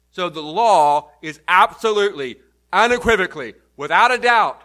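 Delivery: building forceful pulpit emphasis; emphatic preaching. Real, not acted. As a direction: hammer it as absolutely certain. Preacher hammering an emphatic point.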